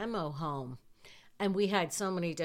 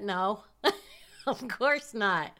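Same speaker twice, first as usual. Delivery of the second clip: bursts out laughing, higher and louder; 3 semitones higher, 6 dB louder, slower than usual. Real, not acted. Recalling driving over the sidewalk, laughing out loud. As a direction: laugh through the words, slow.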